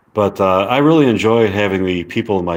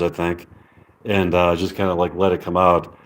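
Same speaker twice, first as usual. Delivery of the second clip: ordinary talk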